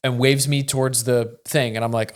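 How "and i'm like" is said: ordinary talk, nothing marked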